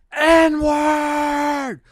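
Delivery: long, high-pitched yell at full lung; imitated screaming. Storyteller imitating the night screamer outside the window, screaming. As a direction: belt it out and hold it. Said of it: "screaming the N-word on the top of his lungs".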